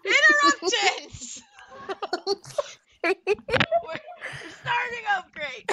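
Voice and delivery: high, loud laughing outburst; cracking up at being interrupted. Real, not acted.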